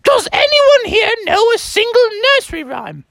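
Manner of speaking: high, impatient teacher goose; Mother Goose. Acted. Teacher moves on to the next pupil, high impatient goose. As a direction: call on the class in falsetto. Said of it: "And she's like".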